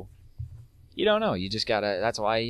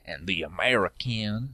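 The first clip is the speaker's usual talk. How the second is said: put-on slow, drawn-out southern American accent; slower than usual. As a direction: stretch it out in a put-on southern twang. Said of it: "say it in a southern voice".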